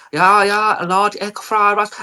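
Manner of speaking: put-on Chinese-accented English; mock Chinese accent. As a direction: imitate a Chinese takeaway worker taking an order. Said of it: "they're like".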